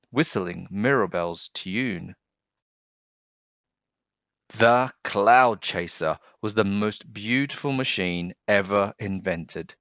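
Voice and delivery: ordinary delivery, nothing marked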